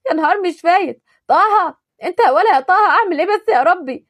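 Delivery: high, loud distressed cries; panicked calling out. Acted. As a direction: cry for help in alarm. Fallen in pit, calling for help.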